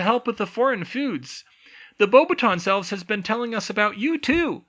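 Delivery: high, loud squeaky elf voice; excited about the visitors; chatty elf teacher. A narrator's reading. Tilly chats in elf voice.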